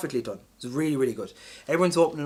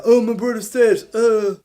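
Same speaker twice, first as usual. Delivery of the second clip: high, loud whiny mock of a mopey man; mock the grieving hero in a high wail; mopey mockery; 7 semitones higher, 9 dB louder than usual. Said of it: "Mopey Mac, fucking mopey face".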